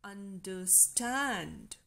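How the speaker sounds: un-der-STAND, slow, long final syllable; anapest stress. Shown as a demonstration. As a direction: stretch and stress "stand" in "understand". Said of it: "Two unstressed syllables followed by a stressed".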